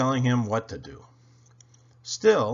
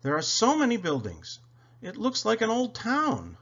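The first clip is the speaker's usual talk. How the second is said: excited cry; 10 semitones higher than usual. A narrator's reading. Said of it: "he cried".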